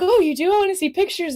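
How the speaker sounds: high, eager reenacted delight; light up at the news, pitched high; excited. Voice actor asking to see cosplay pictures, excited. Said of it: "like, all excited".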